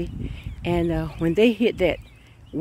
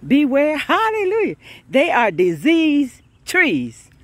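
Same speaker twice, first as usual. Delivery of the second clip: high, loud, slow preaching cry; full-force preaching; 7 semitones higher, 5 dB louder, slower than usual. Real, not acted. Preacher whooping to the congregation, loud and slow. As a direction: lift it up and stretch it out.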